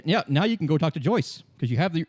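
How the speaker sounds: ordinary talk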